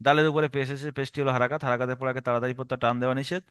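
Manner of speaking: low, fast rattled-off recital; mimicking a student's hurried drill; students' wrong method. Shown as a demonstration. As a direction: gabble the rule in a low monotone. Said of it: "এটা একটা রং পদ্ধতি".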